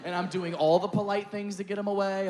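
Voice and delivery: ordinary talk, nothing marked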